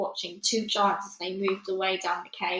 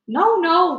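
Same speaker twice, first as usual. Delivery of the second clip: high, loud panicked denial; frightened cry; 9 semitones higher, 11 dB louder than usual. Acted. The BFG denying to the Bloodbottler, high panicked loud cry. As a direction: cry out desperately. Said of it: "cried the B F G".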